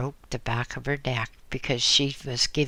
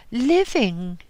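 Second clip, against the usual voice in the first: blurted out, higher and louder; abrupt answer; 10 semitones higher, 6 dB louder than usual. Acted. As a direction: snap the word out at once. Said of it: "she answered abruptly".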